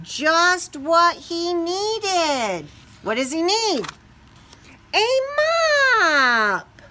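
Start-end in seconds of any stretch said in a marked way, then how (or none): 4.9-6.8 loud, high triumphant shout; excited reveal. A narrator's reading. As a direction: shout out the answer. Reveals what he found.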